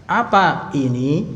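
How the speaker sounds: "Apa ini" drawn out slowly, lifting into a question; question intonation. Shown as a demonstration. Teacher shows class how to ask what this is, questioning intonation. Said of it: "Kalau pakai intonasi gitu ya".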